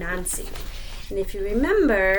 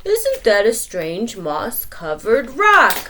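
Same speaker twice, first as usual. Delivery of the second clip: slow, louder wondering; wondering lion; 2 semitones higher, 8 dB louder, slower than usual. Acted. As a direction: marvel at the rock. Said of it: "said the Lion".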